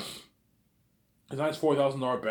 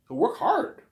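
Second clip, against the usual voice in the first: high, loud sharp push; high mock order to work; mock Peter yell; 6 dB louder, slower than usual. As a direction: shout it like a pushy boss. Peter acted, high yelled.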